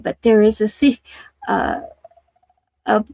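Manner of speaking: stumbling, halting over the words; stuttering. Real, not acted. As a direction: stutter and restart the phrase. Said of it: "I'm sorry to be stuttering".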